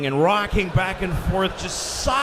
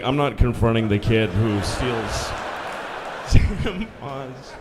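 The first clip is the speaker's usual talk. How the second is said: alçak, umursamaz kasiyer; low, indifferent store clerk; 11 semitones lower, slower than usual. Retold or imitated. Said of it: "he's like".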